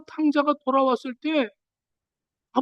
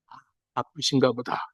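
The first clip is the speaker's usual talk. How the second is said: a soft, lower, easygoing sigh of understanding; calm understanding; 14 semitones lower, 6 dB quieter than usual. Retold or imitated. Driver who was cut off shrugs it off calmly. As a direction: shrug it off kindly. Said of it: "관계가 회복된 사람은 이럽니다".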